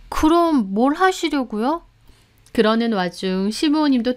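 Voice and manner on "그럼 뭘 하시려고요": higher, slow questioning voice; puzzled housekeeper. A narrator's reading. Housekeeper asks her employer's plans, puzzled. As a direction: draw out the question, pitched up.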